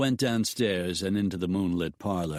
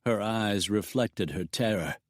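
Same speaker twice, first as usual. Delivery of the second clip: usual speaking voice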